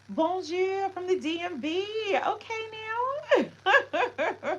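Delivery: high, lifted singsong hello; excited greeting. Real, not acted. Streamer greeting chat from the DMV, high and bright.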